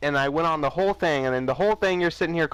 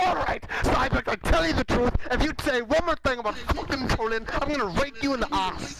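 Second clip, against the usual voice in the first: higher put-on voice mocking the caller's threat; mock impression; 7 semitones higher than usual. Mocking a channel guest's threat, lightly voiced higher. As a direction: ham up the other guy's angry threat in a higher voice. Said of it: "Let's remember the one who came in".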